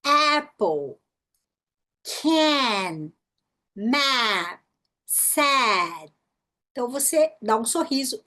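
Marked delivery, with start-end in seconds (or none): 0.0-1.0 "Apple" with A mouth and E sound; exaggerated ae in "Apple"; short A. Shown as a demonstration. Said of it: "boca de A e som de E". 2.0-6.1 "Can, Mad, Sad" with a long wide ae; smile-stretch the vowel in "Can, Mad, Sad"; slow, exaggerated demo words; short A. Shown as a demonstration. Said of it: "A curto do inglês".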